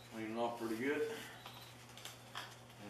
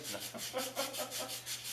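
breathy laugh; 11 semitones higher, 8 dB quieter than usual. Real, not acted. Laughs at own weight joke.

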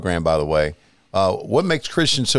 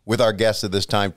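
ordinary talk

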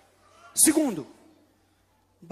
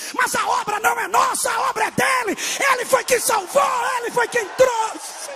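full-force preaching; 12 semitones higher, 8 dB louder than usual. Acted. Preaching climax.

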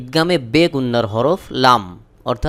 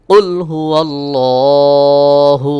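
slow, melodic tilawat, louder; Quran recitation; 7 dB louder, much slower than usual. Acted. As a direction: chant it long and even. Recites the verse. (ধীর সুরেলা কুরআন তিলাওয়াত)

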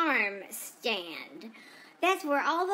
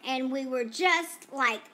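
normal talking voice

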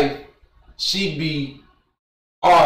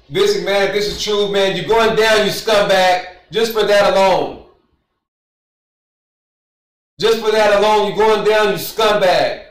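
louder, higher angry call-out at McMahon; 6 semitones higher, 5 dB louder than usual. Real, not acted. Streamer angrily calling out Vince McMahon, loud and heated. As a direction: tell him off like you mean it.

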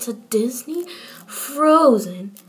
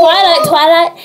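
calling out; 5 semitones higher, 9 dB louder than usual. Pretend. Calling to Twilight.

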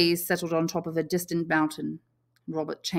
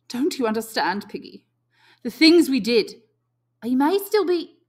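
louder, rising urgency; urgent; 8 semitones higher, 10 dB louder than usual. Acted. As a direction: press him to understand. Ralph pressing, louder.

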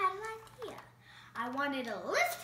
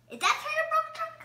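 much higher, fast and louder; eager guess; 11 semitones higher, 7 dB louder, much faster than usual. Acted. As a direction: rattle it off high and eager. Piggy guesses again.

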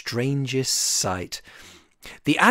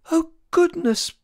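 whimpering; 13 semitones higher than usual. A narrator's reading. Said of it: "poor Chick whimpered".

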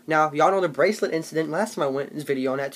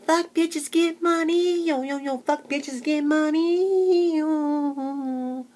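bad, much higher and slow rap impression; [name] and [name]; 11 semitones higher, much slower than usual. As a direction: croon the chant like a clumsy pop-rap star. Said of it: "my [name] and [name] impersonation".

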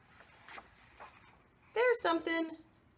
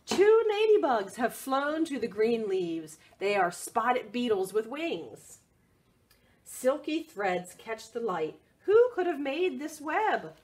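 lively picture-book read; reading to children; 2 semitones lower, 5 dB louder than usual. Acted. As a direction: read it out brightly for little ones.

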